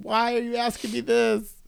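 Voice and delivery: high-pitched, drawn squirming question; retold awkward deflection.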